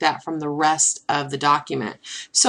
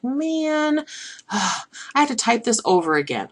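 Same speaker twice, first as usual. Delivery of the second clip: mock exasperation; 6 semitones higher than usual. Instructor voicing a user facing retyping, mock exasperated. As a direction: groan it like a mildly put-out user.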